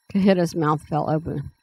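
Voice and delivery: ordinary delivery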